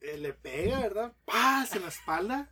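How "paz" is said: golpe de látigo imitado, fuerte. Acted. Imita el latigazo.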